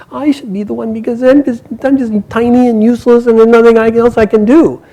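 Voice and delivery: put-on high, small child's voice pleading; little boy voice. Little son begs to be sacrificed, in a high child's voice. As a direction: pitch way up like a tiny useless boy.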